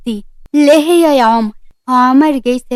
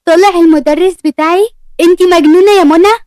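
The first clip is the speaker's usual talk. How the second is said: higher, louder disbelieving outburst; outraged; 5 semitones higher, 6 dB louder than usual. Acted. Ward yelling at Mona over loving her teacher. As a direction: blow up at her aunt.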